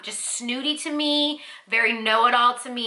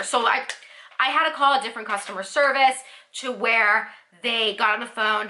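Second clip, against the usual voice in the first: ordinary talk, nothing marked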